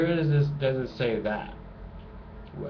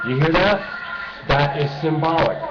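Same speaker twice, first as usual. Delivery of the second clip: loud, higher-pitched call for attention; raised, emphatic voice; 5 semitones higher, 6 dB louder than usual. Real, not acted. Pointing audience to the loud noise, raised emphatic voice. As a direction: project over the noise, driving the point home.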